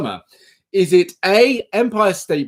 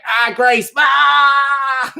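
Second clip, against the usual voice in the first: high, loud, gloating shout at Grace; mock triumphant shout; 9 semitones higher, 4 dB louder, slower than usual. Taunting Grace about primary school, high and loud. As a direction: crow over her win like a playground rival. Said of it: "Just swoop in and be like".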